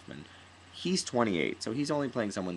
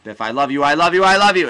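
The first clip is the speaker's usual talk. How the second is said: mock stage scream, climbing higher and much louder; building to a scream; 9 semitones higher, 18 dB louder, faster than usual. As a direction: escalate fast into a high, very loud yell. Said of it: "until I was screaming".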